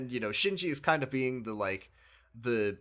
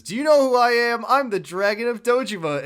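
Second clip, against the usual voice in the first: much higher, much louder bragging shout; hammed-up boast; 12 semitones higher, 10 dB louder than usual. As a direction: yell it like a puffed-up impostor. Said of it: "the fake Kiryu says".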